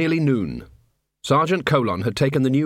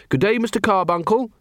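put-on higher, friendly young voice; cheerful greeting; 7 semitones higher than usual. A narrator's reading. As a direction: greet him brightly. Carrot greeting a troll.

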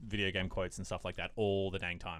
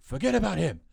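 coach's call; 10 semitones higher, 5 dB louder than usual. Retold or imitated. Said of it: "It's like".

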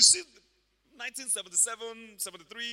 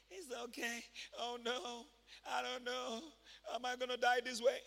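soft, feeble, put-on worrying; mock helpless whimper; 4 semitones higher, 5 dB quieter than usual. Believer under the devil's attack, mock feeble whimper. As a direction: mimic a defeated believer quietly fretting.

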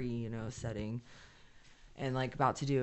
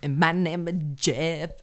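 silly put-on voice; 9 dB louder, slower than usual. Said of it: "you sound so better".

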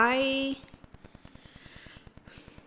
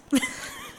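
high squeal laugh. Real, not acted. Laughing at own bit.

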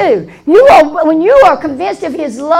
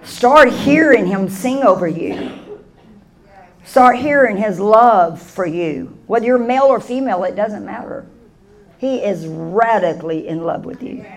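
soft, lower, intimate reassurance; tender and soft; 8 semitones lower, 7 dB quieter than usual. Acted. Preacher assuring the listeners of love, soft and tender. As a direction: speak it gently like a closing blessing.

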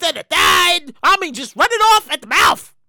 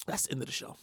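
breathy whisper, much lower and softer; whispered sign-off; 20 dB quieter, faster than usual. Real, not acted. Teasing listeners that the show is over, whispered. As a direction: tease the ending under your breath.

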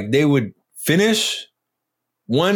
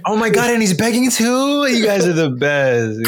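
gushing; 8 semitones higher than usual. Acted. Johnny gushing over the gift.

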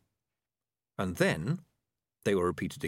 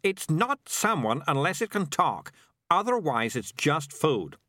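slow, higher, emphatic correction; drawn-out, louder-edged insistence; hissed objection; 8 semitones higher, 4 dB louder, slower than usual. A narrator's reading. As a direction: insist on it, irritated. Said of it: "he hissed".

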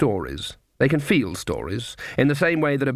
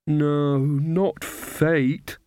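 dark; 3 semitones higher, much slower than usual. A narrator's reading. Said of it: "said Magrat darkly".

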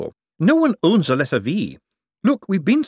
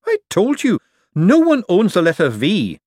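high, louder insistence; insistent young man; 10 semitones higher, 7 dB louder than usual. Acted. Young man insisting to the forger, louder. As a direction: raise it, he's cornered.

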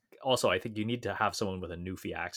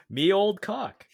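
mock Newfoundland accent; 11 semitones higher, 7 dB louder, slower than usual. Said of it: "thick Newfoundlander accent".

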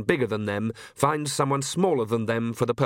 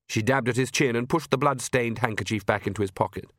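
usual speaking voice

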